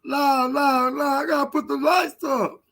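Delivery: high, mock-tearful protest; mock him refusing, close to tears; hammed-up crybaby whine; whiny rival impression. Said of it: "Sound like he about to cry".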